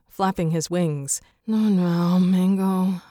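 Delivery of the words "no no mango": plain reading voice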